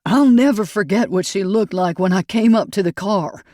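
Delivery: husband recalls finding the car, tearful. A narrator's reading. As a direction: let tears rise through the words. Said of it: "Tears glistened in Sanchez's eyes".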